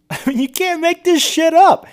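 high, laughing disbelief; incredulous laughing. Real, not acted. Commentator to listeners after reading the study result, laughing in disbelief.

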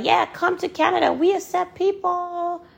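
high, fast, sing-out mocking welcome; hammed-up high-pitched come-on; mock sales pitch. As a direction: parody an over-eager recruiter selling a dream. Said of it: "they sell you a dream, they're like".

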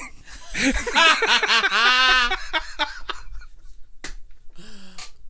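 long laugh. Real, not acted. Laughs at own punchline.

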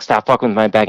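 ordinary delivery

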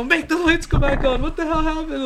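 high-pitched mock kid panic; panicked little kids. Kids begging grandfather for the lights back, mock panic. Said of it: "We're all little kids too".

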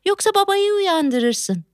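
ordinary talk

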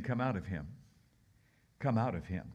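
low, commanding Jesus voice; stern command. Acted. Preacher voicing Jesus commanding the demon, low and stern. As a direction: order the spirit out firmly.

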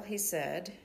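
usual speaking voice

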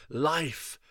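lightly voiced high cry of life, life. A narrator's reading. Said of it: "ran on crying".

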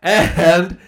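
high, loud, slow announcer voice; announcer shout-out. Acted. Host thanks Patreon tiers, high slow announcer call. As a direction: hype each name like a game show host. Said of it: "thanking supporters in a showy voice".

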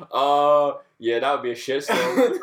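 casual imitation. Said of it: "the way you just said that so casually".

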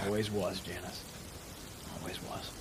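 very low, fading murmur; quiet, tender creature. Acted. Ueg reassuring Janice that they were heard, low and hushed. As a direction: let it sink to a soft, low hush. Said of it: "and he goes".

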